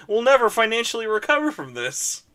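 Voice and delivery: high, loud, laughing mock wail; mock dramatic despair. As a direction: wail it like a ruined fan laughing. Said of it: "and we were like".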